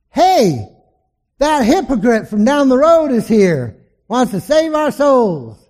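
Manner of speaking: high, loud jeering call to the next room; loud scornful yell; mocking yell. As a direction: shout it across the house to your card buddies. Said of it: "yells to his friends".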